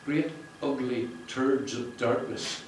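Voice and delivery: slow, deliberate reading of Great ugly turds of darkness; read aloud slowly. Shown as a demonstration. Reading the author's line to the launch crowd, slow and deliberate. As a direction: lay out each word of the passage. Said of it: "if I can read it with that distance there".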